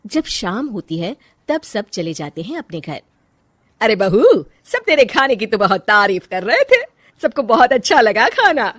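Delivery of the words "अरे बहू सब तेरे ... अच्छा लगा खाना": louder, cheerful mother-in-law voice; pleased mother-in-law. Acted. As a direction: pass on the praise brightly. Mother-in-law praising food. (खुश सास की आवाज़)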